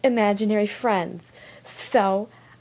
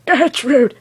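put-on loud, rough stab at an old man; lightly voiced old man shouting out; attempted old man; 5 semitones higher, 13 dB louder than usual. Shown as a demonstration. Showing an old man voice, half-successful loud old-man call. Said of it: "I can sort of do an old man one".

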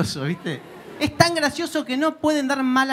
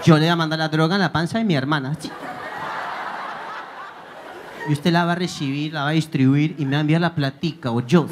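Pablo Escobar; 7 semitones lower than usual. Retold or imitated. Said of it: "Sí, Pablo". (Pablo Escobar, acento paisa grave)

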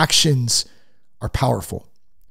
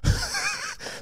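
short laugh; 11 dB quieter than usual. Real, not acted. Laugh after sarcastic line.

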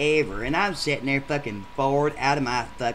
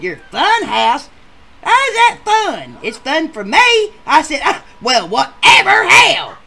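high, loud, slow mockery; loud, exasperated brush-off; scoffing yell; 13 dB louder, slower than usual. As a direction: scoff loudly at her. Ed scoffing at date.

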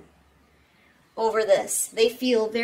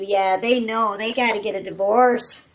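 ordinary delivery

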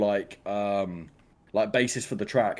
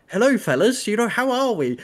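high, louder mock-cheery hello; high chirpy greeting; 10 semitones higher, 5 dB louder than usual. Newcomer greeting Discord strangers, high mock-cheery voice. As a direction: greet the group in a raised voice.

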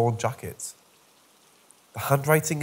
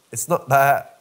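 insistent; 7 dB louder than usual. Acted.

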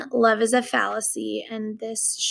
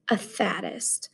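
whispered scorn; 3 semitones lower, 8 dB quieter, slower than usual. Acted. Narrator scorning his roommate, whispered. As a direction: drop to a whisper for the insult.

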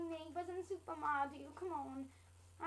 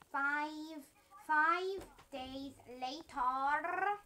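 slow, drawn-out caption read, louder; time-card announcer; 5 dB louder, much slower than usual. Pretend. Announcing a jump of five days, slow announcer voice. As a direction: stretch each word like a cartoon time card.

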